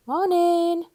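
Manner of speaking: high, bright and loud hello; cheerful greeting. A narrator's reading. The worm cheerfully greeting Mister Jelly, high and loud. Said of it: "said the worm cheerfully".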